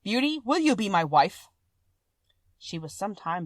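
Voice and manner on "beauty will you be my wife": louder monster voice asking; a hopeful, earnest monster; Beast voice. A narrator's reading. Beast asks Beauty to marry him, louder.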